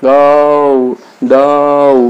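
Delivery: গৌ and দৌ said as clear gliding diphthongs; sound demo. Shown as a demonstration. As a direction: model গৌ, দৌ one by one. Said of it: "এভাবে সাউন্ডটা করতে হবে". (গৌ, দৌ ধ্বনি দেখানো)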